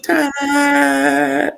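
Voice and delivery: high triumphant ta-da; mock ta-da. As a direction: present it like a magic trick. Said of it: "And I was like".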